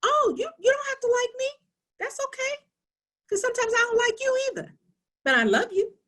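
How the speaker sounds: high, far mock-sweet mom voice, quick and teasing; playful high motherly voice; mother to her children. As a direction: sing it sweetly to the kids, half in jest. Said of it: "I used to tell my children".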